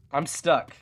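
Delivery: normal talking voice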